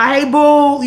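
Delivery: flirty greeting. Retold or imitated. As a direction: flirt to make him blush. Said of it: "just trying to get him to blush".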